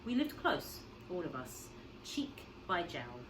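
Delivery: usual speaking voice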